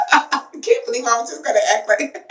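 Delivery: amused at yourself, words through laughter; real giggle running into the line; laughing. Real, not acted. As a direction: laugh while talking. Laughs at her own acting.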